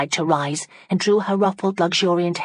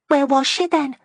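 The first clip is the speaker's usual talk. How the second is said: put-on higher, eager young woman; young lady Fanny; 11 semitones higher, 4 dB louder than usual. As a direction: ask it high and eager. Fanny voiced in novel.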